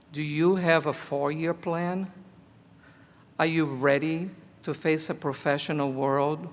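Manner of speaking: ordinary talk, nothing marked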